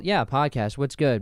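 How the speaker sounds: normal talking voice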